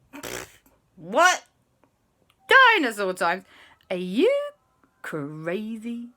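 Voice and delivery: loud, incredulous outburst; scoff, then exclaim in disbelief. Acted. Relatives hear of time travel, incredulous.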